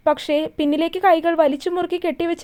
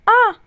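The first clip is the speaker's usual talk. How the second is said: pained cry; 6 semitones higher, 6 dB louder than usual. A narrator's reading. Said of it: "Devu വേദനയോടെ ശബ്ദമുണ്ടാക്കി".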